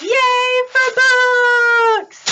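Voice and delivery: playful long-held hooray; cheering. Pretend. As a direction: cheer it out for little listeners. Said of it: "I love cheering for books".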